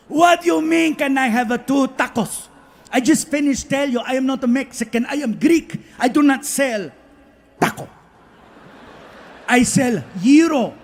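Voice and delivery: put-on Greek accent. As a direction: lecture him in a Greek accent. Greek vendor lecturing.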